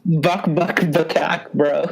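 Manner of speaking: ordinary talk, nothing marked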